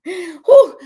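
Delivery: big exhale whew. Real, not acted. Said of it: "I'm, like, sweating".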